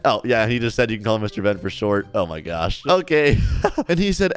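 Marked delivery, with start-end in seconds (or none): none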